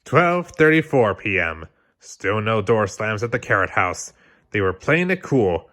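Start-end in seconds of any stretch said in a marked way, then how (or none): none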